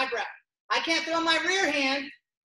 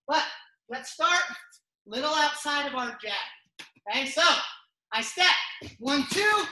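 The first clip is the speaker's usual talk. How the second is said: ordinary delivery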